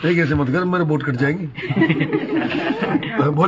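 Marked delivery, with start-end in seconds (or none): 1.5-3.2 high burst of laughter. Real, not acted. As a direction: laugh out at the refusal. Politician laughs at refusal.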